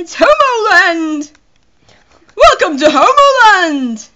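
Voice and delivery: loud, high, slow announcer call; over-the-top town welcome; mock grand announcement. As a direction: belt out a drawn-out welcome. Reads town name as announcer.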